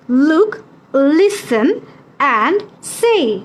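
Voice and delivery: word by word, pausing after each; teacher's model reading. Shown as a demonstration. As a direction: say each word separately for pupils to repeat. Models phrase for pupils to repeat. (থেমে থেমে, একটি একটি শব্দ করে)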